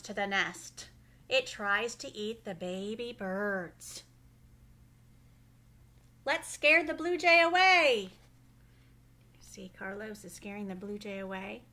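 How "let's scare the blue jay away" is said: bold call. Acted. Kids try to scare blue jay.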